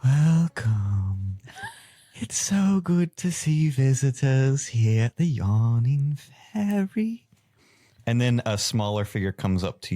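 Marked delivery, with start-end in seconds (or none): 0.0-7.2 high, airy elven barkeep voice; dreamy elf voice. Acted. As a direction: float the welcome up high and breathy. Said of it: "as she looks at you and goes".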